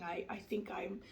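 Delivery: normal talking voice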